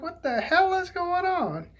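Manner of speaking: high, slow, soft-voiced disbelief; drawn-out, high-pitched bewilderment; baffled exclamation. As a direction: act out puzzled alarm under your breath. Acting out his baffled reaction.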